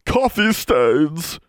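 high, drawn-out elf screech; anguished screech. A narrator's reading. Elf appalled by soiled books, screeching in anguish. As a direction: screech it in horror. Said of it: "screeched Poe that night in anguish".

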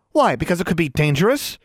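indignant challenge, pitched high; rising voice. A narrator's reading. Girl challenging a friend's refusal, voice rising. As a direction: push the question up in protest. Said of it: "Anna's voice was rising".

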